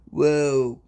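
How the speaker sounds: ordinary talk, nothing marked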